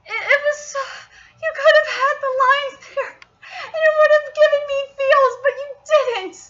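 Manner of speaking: high-pitched, pleading complaint; upset fan's high wail; exasperated lament. Real, not acted. Fan laments cut emotional lines.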